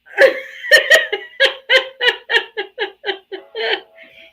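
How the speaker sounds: high, loud laughing fit; can't stop laughing. Real, not acted. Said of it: "Oh, I'm crying".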